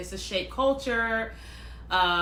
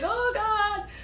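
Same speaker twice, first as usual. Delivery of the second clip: high-pitched, drawn-out child voice, imitated; shy little boy; 11 semitones higher than usual. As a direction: mimic a bashful little kid, way up high. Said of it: "got so shy".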